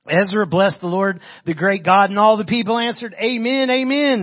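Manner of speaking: ringing public Bible reading; scripture reading. Acted. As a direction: proclaim the verse to the congregation. Reads scripture aloud to congregation.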